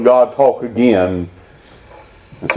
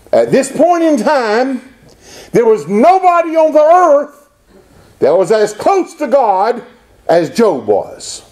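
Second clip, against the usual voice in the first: high, ringing preacher's proclamation; impassioned preaching; 10 semitones higher than usual. Real, not acted. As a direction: preach it with rising force, landing the final words. Preaching at full force.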